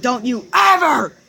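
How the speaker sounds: high, loud furious yell; a dad yelling a stern warning; retold scream. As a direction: scream it right in the kid's face. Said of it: "and then he screamed".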